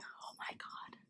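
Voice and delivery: retold breathless whisper of relief. Told her dog was found, whispering in relief.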